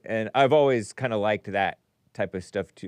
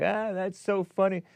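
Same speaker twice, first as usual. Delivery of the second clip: higher-pitched amused reaction, quoting yourself; 8 semitones higher than usual. Retold or imitated. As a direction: act out your first delighted take, pitched up. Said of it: "I was like".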